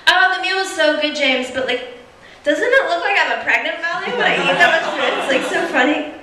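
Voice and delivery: high, loud, gushing date; chattering away in a high, loud girlish voice; worst Bond girl. Acted. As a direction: play a hammed-up ditzy date. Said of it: "It would be the worst Bond girl in the world".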